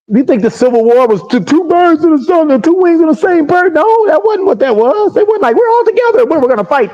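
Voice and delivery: high-pitched, incredulous rebuttal; incredulous scoffing. Real, not acted. Coach rebuts the two-wings idea, high and incredulous.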